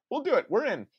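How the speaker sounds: eager high yes. Retold or imitated. Quoting eager Spike Jonze.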